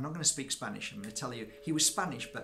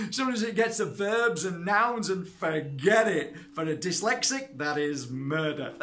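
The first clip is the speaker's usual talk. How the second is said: comic exasperation; 4 semitones higher, 8 dB louder than usual. Real, not acted. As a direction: protest it loudly, stretching the punchlines. Comic protest about learning grammar.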